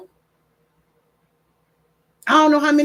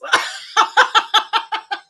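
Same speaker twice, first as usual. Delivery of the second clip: high, loud peals of laughter; uproarious laugh; 9 semitones higher, 6 dB louder than usual. Real, not acted. Grandmother losing it over the business line, uproarious laughter. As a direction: lose it completely at the punchline.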